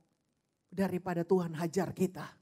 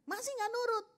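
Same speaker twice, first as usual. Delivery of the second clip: high-pitched exasperated parental warning; raised intonation; 12 semitones higher than usual. Acted. Parent to child who still disobeys, raised intonation. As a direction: jump the pitch up sharply. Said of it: "Nah, naik tuh intonasinya".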